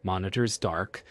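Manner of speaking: usual speaking voice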